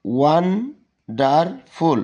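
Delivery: "Wonderful" very slowly, won... der... ful; three separate, drawn-out beats on "wonderful"; broken into syllables. Shown as a demonstration. Teacher repeating the example for students, "wonderful" split in three. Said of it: "এটা তিনবারে".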